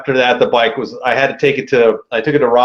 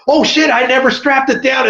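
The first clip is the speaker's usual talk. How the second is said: high, retold jolt of alarm; sudden "uh-oh" outburst, pitched well up; panicked realization; 10 semitones higher than usual. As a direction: blurt it as the thought hits.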